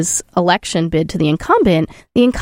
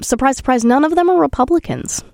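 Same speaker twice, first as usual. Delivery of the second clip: sarcastic; 6 semitones higher than usual. Real, not acted. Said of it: "Oh, sarcasm".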